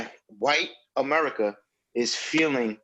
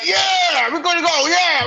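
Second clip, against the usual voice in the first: much higher, louder eager yell; hyped sidekick; 14 semitones higher, 8 dB louder, slower than usual. Retold or imitated. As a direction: shout along like the excited buddy. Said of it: "his boy's like".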